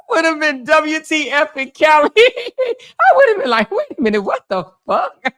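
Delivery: high, laughing mock shock; laughing disbelief. Real, not acted. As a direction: crack up as you picture the shock. Imagining her shocked reaction.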